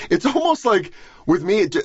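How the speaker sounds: ordinary delivery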